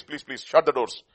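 usual speaking voice

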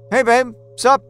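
high, loud, overdone casual greeting; forced casual. Acted. Man hiding the creature greets Laura, falsely breezy. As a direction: feign nonchalance, pitched too high.

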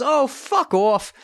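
high, drawn-out retort; mock brush-off. Woman rejecting a confession in the car, mock dismissive. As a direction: brush them off in a high, stretched voice.

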